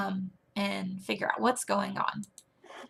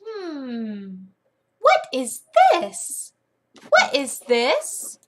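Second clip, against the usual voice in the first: exaggerated slow, high-pitched questioning; big puzzled sing-song, stretched words; playful teacher; 4 semitones higher, 5 dB louder, much slower than usual. Shown as a demonstration. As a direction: wonder aloud theatrically for a small child. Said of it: "you'll say".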